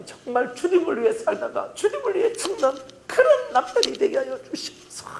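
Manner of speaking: high, laughing and crying as she pleads; a mock sob of a prayer; half-joking weepy plea to God; hammed-up tearful prayer. Wife's tearful prayer for husband.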